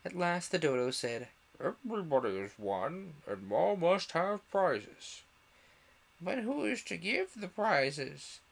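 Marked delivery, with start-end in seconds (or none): 1.6-5.2 slow, raised dodo voice; dodo pronouncement. A narrator's reading. Dodo announcing to all after long thought, grandly drawn out. 6.2-8.4 high, slow questioning character voice; chorus of voices. A narrator's reading. Crowd of animals asking the dodo, high chorus voice. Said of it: "quite a chorus of voices asked".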